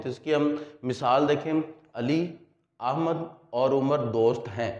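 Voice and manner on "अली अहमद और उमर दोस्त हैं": Ali, a short pause, then Ahmad और उमर दोस्त हैं; brief comma pause. Shown as a demonstration. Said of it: "इसमें जितना मैंने तवक्कुफ़ किया". (अली के बाद मुख्तसर ठहराव)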